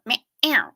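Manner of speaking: high imitated cat meow, falling at the end; a quick cat meow in the host's voice. Shown as a demonstration. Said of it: "Does she split the meow up?".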